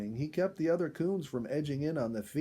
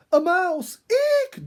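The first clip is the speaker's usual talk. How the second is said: shrill panicked scream at a mouse; a woman spots a mouse and screams; terrified shriek; 13 dB louder, much slower than usual. A narrator's reading. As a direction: shriek it, high and loud, in fright. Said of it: "Dorothy cried".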